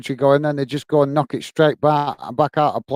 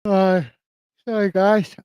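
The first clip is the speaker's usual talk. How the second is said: yawning; 5 semitones higher, slower than usual. Real, not acted. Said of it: "I'm yawning a bit".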